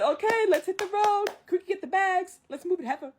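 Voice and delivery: high, loud rallying call; rally everyone with playful bossiness; Mushu's bossy orders. Retold or imitated. Mushu gives orders to set off.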